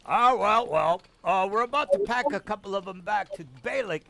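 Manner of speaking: lightly voiced contemptuous Templar; sadistic Templar. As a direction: sneer at the measles like a cruel soldier. Templar answering the inspectors.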